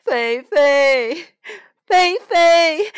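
loud, high calling of a name. A narrator's reading. Mrs Jones tearfully calling for her lost Fifi, loud and high. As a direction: call out across the show ground for her lost dog. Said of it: "她呼唤着".

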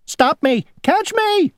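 very high, squealing plea; panicked cry. A narrator's reading. Worm in a rolling apple to Grasshopper, high panicked cry. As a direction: cry out in high-pitched alarm. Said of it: "cried the worm".

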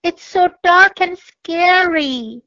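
a hint of fear in the dark; mildly scared. A narrator's reading. Cat and mouse telling the owl, mildly scared. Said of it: "little mouse and cat".

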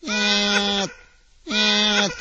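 high, slow imitated meow; a hidden cat crying during family time. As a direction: draw out a plaintive mew. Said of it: "泣き声がした".

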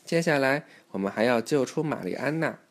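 ordinary delivery

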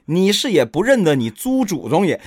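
high, loud, smug taunt; Bajie copying Monkey. Acted. Bajie mocks the demon in a borrowed Monkey voice. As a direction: lord it over the demon, grinning. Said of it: "这话就是跟孙悟空学的".

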